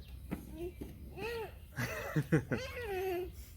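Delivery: amused laugh. Real, not acted. Laughs mid-sentence.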